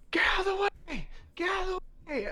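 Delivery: high, frantic yell. Acted. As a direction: scream at the crowd to move. Guard yelling at the crowd.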